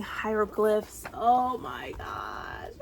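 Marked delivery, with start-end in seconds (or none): 1.1-2.7 awed. Real, not acted. Low slow awe.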